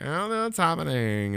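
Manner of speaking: put-on high, lost voice of a girl at her first game; clueless girlfriend. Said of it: "I'm felt like a girl".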